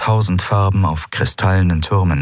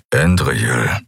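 lower-pitched man's grumble; 5 semitones lower than usual. A narrator's reading. Kero cuts Endriel short, grumbling. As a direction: grumble her name in a low voice. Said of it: "brummte er".

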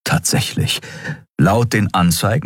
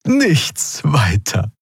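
modest shrug; 8 semitones higher, much slower than usual. A narrator's reading. Old Draxyl downplaying danger.

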